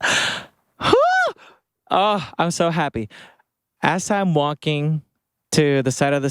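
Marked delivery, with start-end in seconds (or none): 0.0-1.3 gasp, then a high whew; overwhelmed whew. Real, not acted. Vlogger reliving a free [name] ticket, gasping whew. Overwhelmed before saying so happy.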